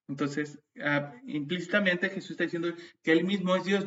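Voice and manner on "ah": ordinary delivery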